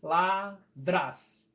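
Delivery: palavras split as pa... la... vras; very slow, with a gap after each syllable of "palavras". Shown as a demonstration. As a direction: break "palavras" into three separate beats. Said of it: "fazer a separação das sílabas".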